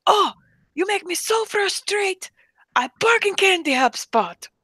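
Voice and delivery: very high, exasperated mock Arabic accent; hammed-up high Arabic-accented complaint; frustrated Arabic accent. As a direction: wail in frustration with a put-on Jordanian accent. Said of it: "somebody with a strong accent".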